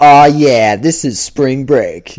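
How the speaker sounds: loud, drawn-out mock cheer; big slow party-guy exclamation; acted party enthusiasm. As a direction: savor it like a reveler who has arrived. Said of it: "that's where you were like".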